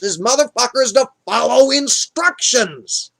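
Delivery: irate dead prophet. Retold or imitated. Samuel scolding Saul for not following instructions, exasperated. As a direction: drive the scolding home with exasperation. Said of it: "he's not happy".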